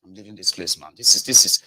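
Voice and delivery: ordinary talk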